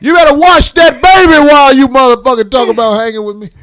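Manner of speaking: high, loud comic rant; ranting shout. Real, not acted. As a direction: shout it out in mock outrage. Ranting at Doctor Phil.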